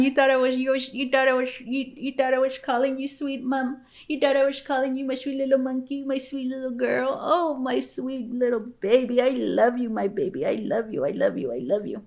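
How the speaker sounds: doting, cooing pet voice. Real, not acted. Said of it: "talk to her in that voice".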